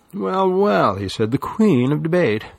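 high, mocking sing-song; mocking greeting. A narrator's reading. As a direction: tease her like royalty. Oh Boy mocking Lily.

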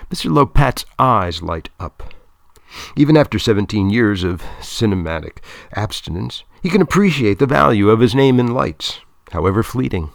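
plain reading voice

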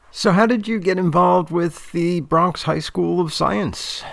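slow, measured interview question; interviewer role. Acted. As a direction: lightly play a deliberate interviewer, stretching the words. Poses next interview question.

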